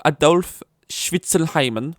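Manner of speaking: put-on high, loud, slow German accent; mock German accent. German parent proposing the baby's name, in a mock German accent. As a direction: draw the name out in hammed-up German. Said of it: "German's like".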